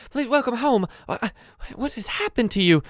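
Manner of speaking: high-pitched, anxious fussing; flustered old goblin maid. Acted. Maid welcomes her injured lord, high and flustered. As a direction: fuss over your master in a high voice.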